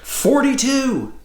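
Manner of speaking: high, drawn-out call; shouted answer. Acted. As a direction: sing out the number slowly, pitch way up. Answer shouted back to the question.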